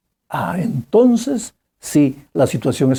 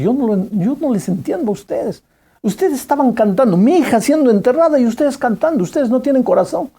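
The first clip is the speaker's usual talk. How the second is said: angry father; 8 semitones higher than usual. Retold or imitated. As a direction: accuse the singers of having no heart, voice climbing. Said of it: "le dijo a la chica agresivamente".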